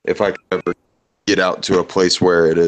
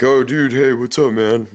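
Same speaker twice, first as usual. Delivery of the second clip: cheery greeting; 6 semitones higher than usual. Retold or imitated. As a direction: greet him like a long-lost friend. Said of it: "they're like".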